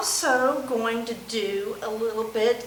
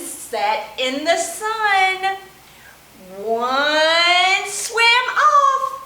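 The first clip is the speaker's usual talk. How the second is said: bouncy, sing-song counting rhyme with whoops; playful children's finger-play chant; storytime action rhyme; 5 semitones higher, 4 dB louder, slower than usual. Acted. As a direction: chant it for little kids doing the actions. Chants five perky penguins rhyme.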